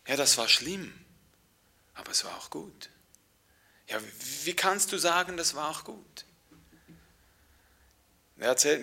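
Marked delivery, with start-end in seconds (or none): 1.9-2.9 whispered refrain. Acted. Quiet refrain.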